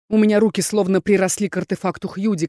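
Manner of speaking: ordinary delivery, nothing marked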